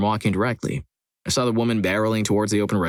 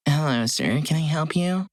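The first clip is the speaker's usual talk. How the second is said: lightly put-on sweet feminine voice; mock feminine, sweetly asking to help; most feminine voice; 7 semitones higher than usual. To the sexist customer demanding a man, mock feminine voice. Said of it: "in my most feminine voice possible".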